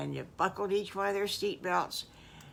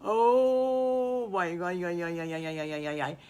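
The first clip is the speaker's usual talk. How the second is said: loud cheer fading down; thrilled cheer; 5 dB louder than usual. Acted. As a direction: start loud and let it fade. Kids thrilled by ocean.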